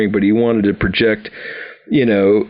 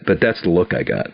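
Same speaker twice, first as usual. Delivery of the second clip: normal talking voice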